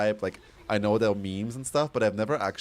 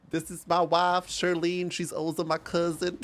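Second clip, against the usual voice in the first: put-on high-pitched Western yokel accent; cowboy Western accent; 7 semitones higher than usual. Streamer as a cowboy presenting wife and cousin, Western accent. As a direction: introduce the family in a high cowboy Western twang. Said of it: "Cowboy would be more Western".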